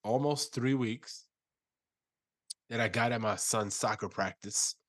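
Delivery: congested, sick voice; under the weather, stuffed up; stuffy nose. Real, not acted. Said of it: "you sound a little under the weather".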